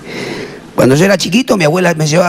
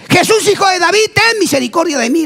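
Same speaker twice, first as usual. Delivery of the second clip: high-pitched calling out; acted shouting; 10 semitones higher than usual. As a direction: plead for mercy in a raised, high cry. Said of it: "le gritaba".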